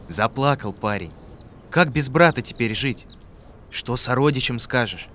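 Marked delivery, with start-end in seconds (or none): none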